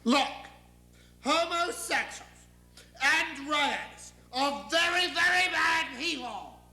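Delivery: exasperated deity, word by word; God. Acted. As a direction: hammer each word out impatiently. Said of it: "don't forget I'm God".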